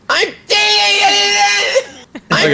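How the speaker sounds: very high cartoon squeal; squealed character voice. Restarting her character introduction, very high squeal. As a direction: squeal it out as a giraffe.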